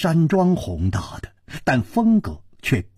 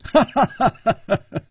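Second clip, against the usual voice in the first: high, staccato amused laughter; easygoing teasing laugh; leisurely laugh; 9 semitones higher than usual. A narrator's reading. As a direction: laugh freely, one ha after another. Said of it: "楚留香悠然说".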